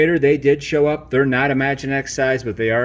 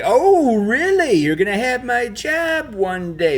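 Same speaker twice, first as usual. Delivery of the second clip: sarcastic mock; 10 semitones higher, slower than usual. Mocking a rival toy.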